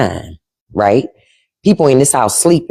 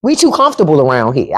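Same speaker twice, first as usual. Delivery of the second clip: ordinary delivery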